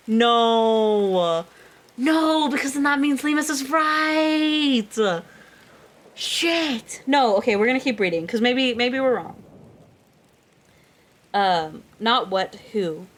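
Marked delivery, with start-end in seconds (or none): 0.1-9.4 high, rising panic; frantic realization; panicked disbelief. Real, not acted. Panics over plot twist.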